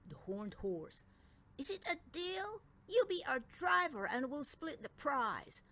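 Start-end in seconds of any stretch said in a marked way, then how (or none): 1.6-5.6 sly offer. Acted. Dragon twins make a deal.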